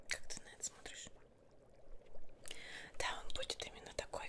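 usual speaking voice

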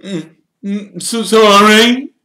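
slow, high and loud showman's voice; vain Lockhart. Acted. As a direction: preen like a celebrity signing photos.

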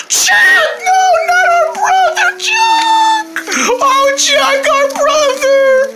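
very high mock lament, half laughing; hammed-up wail. Kids mourning Chuck's kidnapping, hammed-up wail. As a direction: wail dramatically like a play tragedy.

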